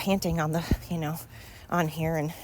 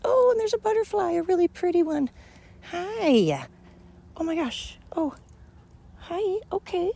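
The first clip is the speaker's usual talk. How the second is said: much higher, cooing to a butterfly; delighted cooing; 7 semitones higher than usual. Real, not acted. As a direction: gush over the pretty butterfly. Talking to a butterfly.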